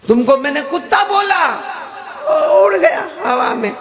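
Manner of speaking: high, laughing mock explanation; teasing taunt. The Maulana teasing the hakim that words fly off, high laughing taunt. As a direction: tease him playfully.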